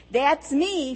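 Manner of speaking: high, bright cry of joy; joyful shout. A narrator's reading. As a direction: shout it out, overjoyed. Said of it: "Fayette Marie shouted".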